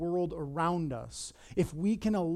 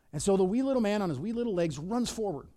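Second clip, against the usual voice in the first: normal talking voice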